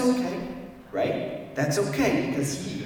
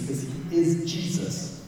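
normal talking voice

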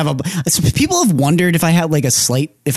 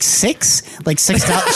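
higher, baffled question with laughter creeping in; puzzled retold reply; 6 semitones higher, slower than usual. Man mishearing a prostitute's offer, puzzled. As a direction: act out your own confusion, pitched up.

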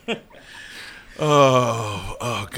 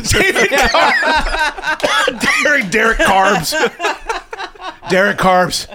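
laughing; 8 semitones higher, much slower than usual. Real, not acted. Laughing through pun names.

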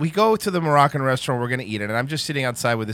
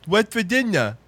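higher-pitched slurred janitor imitation; the janitor's voice; 5 semitones higher than usual. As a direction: answer in the janitor's odd, slurred voice. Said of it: "you be the janitor".

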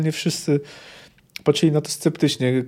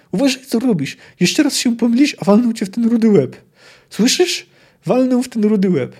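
higher, louder threat of a cuff on the head; angry scolding; 7 semitones higher, 6 dB louder than usual. Acted. Surgeon to his redheaded assistant, angry high scolding. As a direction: snap at the clumsy assistant.

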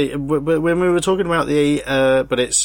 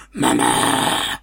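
demon voice. Retold or imitated. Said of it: "he'll be talking like this".

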